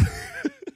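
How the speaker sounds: short, soft high giggle; laughter. Real, not acted. Host giggles after asking co-host for a topic, soft laughter. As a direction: giggle quietly in a very high pitch.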